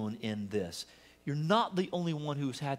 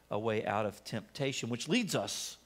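ordinary talk

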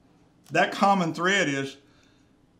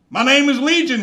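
loud, higher ominous declaration; demon's reply; 7 semitones higher, 7 dB louder than usual. Acted. Demon answering Jesus, loud ominous reply. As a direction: proclaim it as the demon horde.

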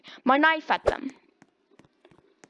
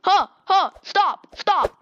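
panicked shouting; 4 semitones higher, 5 dB louder, slower than usual. Real, not acted. Being attacked in game.

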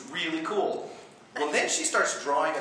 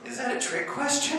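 croaked. Retold or imitated. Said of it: "or croaked".

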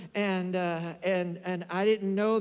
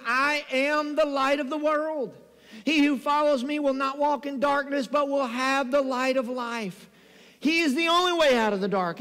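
raised, measured preaching voice; scripture proclamation; 4 semitones higher, 4 dB louder than usual. Real, not acted. Preacher quoting Jesus as the light, proclaiming. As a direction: declare it high and firm, drawing out phrases.

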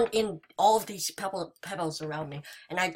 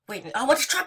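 normal talking voice

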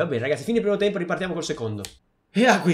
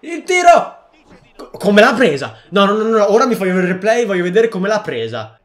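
much higher and louder, disbelieving; excited shout; 5 semitones higher, 9 dB louder than usual. Real, not acted. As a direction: yell then demand the replay. Shouts at shot then disbelief. (grido incredulo)

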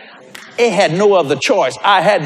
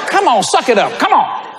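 high, pushy urging; worked-up coaxing; urging pep talk; 8 semitones higher than usual. Retold or imitated. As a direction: egg a reluctant friend on, pitched high. Urging friend to preach boldly.

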